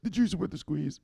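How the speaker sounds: high, small underling voice; meek recital. Acted. Underling ordered by boss to say it, meek recital. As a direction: repeat it obediently without force. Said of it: "So say it".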